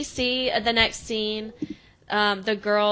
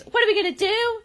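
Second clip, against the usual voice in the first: high, peppy rallying question; Jo's pep talk; 13 semitones higher, 4 dB louder, faster than usual. Retold or imitated. Mom rallying the girls, high and eager. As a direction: whip the team up. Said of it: "and she goes".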